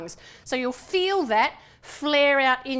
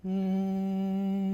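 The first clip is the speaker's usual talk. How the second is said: hummed M; 3 semitones lower than usual. Shown as a demonstration. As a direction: hum with lips shut. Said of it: "We're going to go".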